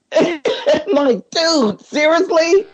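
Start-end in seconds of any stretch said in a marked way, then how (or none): 1.3-2.7 high-pitched disbelief; retold stunned protest, pitched way up; incredulous. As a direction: squeak it out in mock astonishment. Retelling disbelief at the answer.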